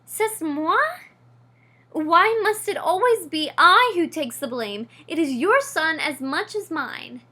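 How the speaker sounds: loud, offended retort in a French lilt; indignant. A narrator's reading. French mother mouse argues with husband, loud indignant retort. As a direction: throw the blame back at him, offended.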